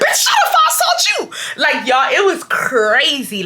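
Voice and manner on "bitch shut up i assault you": retold yell. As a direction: shout him down. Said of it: "I told him, I said".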